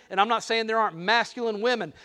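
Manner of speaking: ordinary delivery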